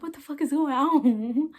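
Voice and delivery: high, loud, drawn-out disbelief; retold shock. Woman retells walking into a surprise with roses, shocked. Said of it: "I'm shocked".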